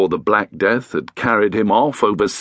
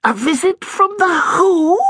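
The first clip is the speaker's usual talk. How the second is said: high, alarmed ghostly hiss; startled ghost Edith. Acted. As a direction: catch a sound and react in alarm as the old ghost. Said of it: "she hissed".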